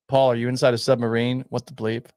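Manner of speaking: normal talking voice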